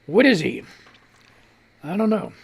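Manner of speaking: ordinary talk, nothing marked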